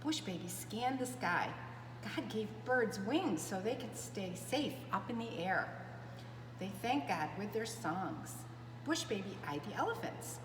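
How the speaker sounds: ordinary delivery, nothing marked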